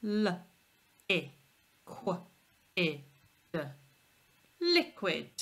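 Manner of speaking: liquid given letter by letter as l, i, qu, i, d, then blended; sounding out carefully. Shown as a demonstration. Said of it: "let's sound it out carefully".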